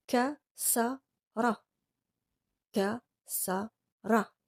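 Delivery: kasara stretched out very slowly; slow model word. Shown as a demonstration. Teacher slowly modelling word for class to repeat. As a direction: draw out ka-sa-ra syllable by syllable. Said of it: "Répétez après moi".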